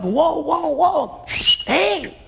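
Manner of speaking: high excited cries of surprise; excited whoa. Acted. Fishermen hauling in the net, high excited whoas. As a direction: call out in amazement at the net. Peter's fishermen see the huge catch.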